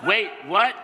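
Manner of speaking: ordinary delivery, nothing marked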